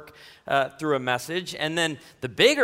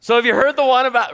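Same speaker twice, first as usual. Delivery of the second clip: joke rescuer; 8 semitones higher, 8 dB louder, faster than usual. Shown as a demonstration. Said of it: "I need to come in and be your rescuer".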